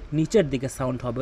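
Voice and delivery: ordinary talk, nothing marked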